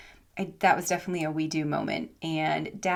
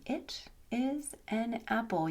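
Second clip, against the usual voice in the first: "It is an apple" soft and very slow, higher pitch; gentle teaching technique; 4 semitones higher, 7 dB quieter, slower than usual. Shown as a demonstration. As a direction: softly space out each word of "It is an apple" for a learner. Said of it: "a little technique".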